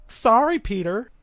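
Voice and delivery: high, singsong fake sorry; mock apology. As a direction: call out a gleefully insincere apology. Mock apology to absent scammer.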